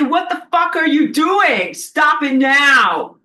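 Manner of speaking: furious shout at a vandal in the library; angry confrontation. Shown as a demonstration. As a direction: yell at the page-tearer to stop, hard. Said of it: "confront them and say".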